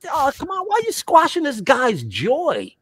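exasperated protest. Retold or imitated. Retold protest at friend.

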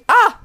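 sudden loud, high yelp; short high scream of alarm; startled yelp. Real, not acted. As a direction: cry out as if caught off guard. Startled while playing the game.

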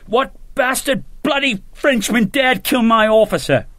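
high furious shout; shouting in a rage. A narrator's reading. Officer bursting into a tavern, raging shout. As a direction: storm in yelling at the room. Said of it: "he shouted".

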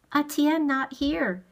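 lightly voiced girl speaking halting English; girl's halting English. A narrator's reading.

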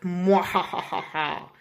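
slow villain cackle; evil laugh. A narrator's reading. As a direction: draw out a mock evil laugh.

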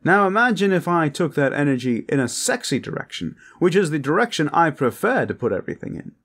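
high, loud, showy come-on; flirtatious braggart Hakan. Acted. Hakan brags to a reluctant guest, flirtatious and over the top.

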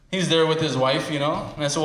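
ordinary talk

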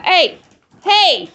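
loud warning hey; scolding hey. Real, not acted. Owner warning a dog trying to steal, loud scolding. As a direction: snap the dog out of it.

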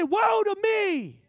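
very high, slow, shouted wail; prophet's cry. Acted. Isaiah crying out in woe, high shouted wail. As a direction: wail it out in despair. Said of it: "Yet he said".

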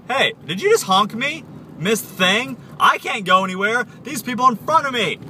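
loud, high-pitched exasperated outburst; road rage. Real, not acted. Driver yelling at someone who honked, road rage. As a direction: snap at another driver, pitch shooting up. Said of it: "so much road rage".

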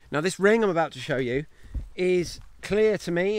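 usual speaking voice

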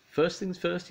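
high, bright character voice; Mister Clumsy voice. Acted. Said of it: "he said".

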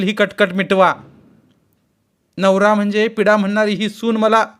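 loud, high indignant complaint. A narrator's reading. Mother-in-law complaining to her husband, loud and indignant. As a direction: rail at the husband about the bride. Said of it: "सासूनं एकदम आवाज चढवला".

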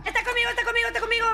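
being chased. Real, not acted. Hunted by the killer in game.

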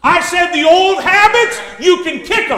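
forceful, building sermon delivery; full-force preaching. Real, not acted. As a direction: drive each point home to the congregation.

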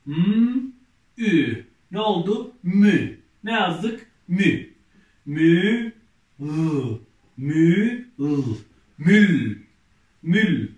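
loud, drawn-out syllable reading for the class; reading syllables aloud. Shown as a demonstration. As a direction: sound out each letter clearly, then blend them loudly. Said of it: "Devam ediyoruz".

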